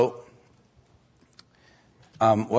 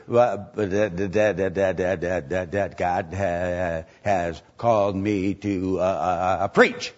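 lower, halting stutter on dad; stuttering imitation; 6 semitones lower, slower than usual. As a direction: stammer through it to his father. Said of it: "but he said".

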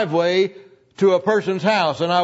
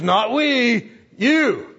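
higher, teasing sibling voice; mock brother; 5 semitones higher, much slower than usual. Brother telling narrator it was his fault, teasing. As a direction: pin the blame back on him. Brother David deflecting blame.

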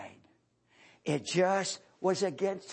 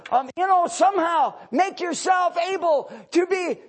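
high, loud revival preaching building to shouting; impassioned sermon climbing to a shout; fiery preaching rising to a shout; 14 semitones higher, 9 dB louder than usual. Acted. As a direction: drive it home to the congregation. Preaching at full force, building to shouts.

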